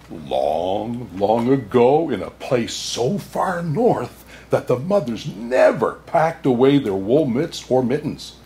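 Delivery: ordinary delivery, nothing marked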